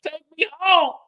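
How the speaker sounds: high, loud swoon; swooning woman. Retold or imitated. Juke swooning at the club, high gushing voice. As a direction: gush like she's swept off her feet.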